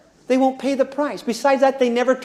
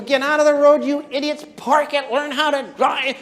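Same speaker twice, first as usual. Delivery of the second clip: high, mock angry yelling at drivers; mock freeway yelling; imitating a road rage yell; 5 semitones higher than usual. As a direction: play the furious driver. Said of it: "yelling at people".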